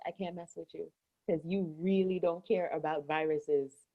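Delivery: softly, like a secret; hushed confiding. Real, not acted. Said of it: "'Cause no one's listening".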